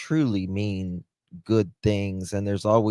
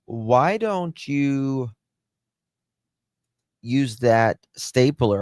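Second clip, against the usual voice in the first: taunting; 4 semitones higher, slower than usual. Real, not acted. Taunting Daniel on live stream.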